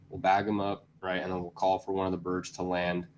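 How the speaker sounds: usual speaking voice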